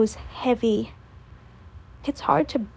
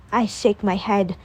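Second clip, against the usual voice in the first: ordinary delivery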